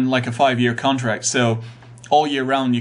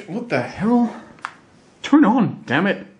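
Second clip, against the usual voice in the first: high exasperated grumble; fed up with a stubborn gadget; 7 semitones higher, slower than usual. Real, not acted. Speaker to a TiVo that won't turn on, exasperated.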